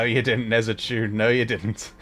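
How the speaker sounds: ordinary talk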